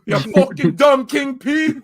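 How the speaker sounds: high, loud mock Jamaican; Jamaican accent. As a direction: imitate a Jamaican woman. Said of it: "it was a Jamaican".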